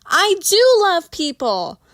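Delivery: high, slow dramatic indignation; mock offended. A narrator's reading. Tipsy Christina pretending to be offended by Bill, hammed-up. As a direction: clutch your pearls, hammed-up. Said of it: "Christina gasps dramatically".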